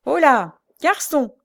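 loud, high call to a waiter. A narrator's reading. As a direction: shout for service. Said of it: "cria le vieux domestique". (appel crié, fort et aigu)

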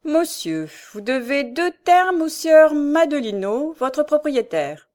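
high, loud, drawn-out formal pronouncement; grave and official. A narrator's reading. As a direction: announce the debt solemnly, word by word. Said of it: "il lui dit avec beaucoup de gravité". (ton grave et solennel d'huissier)

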